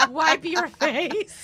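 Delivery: high, loud words through real laughter; helpless laughing delivery, slow and loud. Real, not acted. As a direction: crack up while drawing out the words. Cracking up at own advice.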